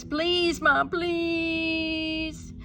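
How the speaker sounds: begging. A narrator's reading. Child begging mom.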